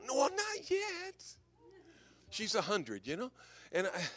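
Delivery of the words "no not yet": put-on high, slow old mother's voice; mom's reply. Son voicing his old mother, high and slow. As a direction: stretch it out like a frail old mom.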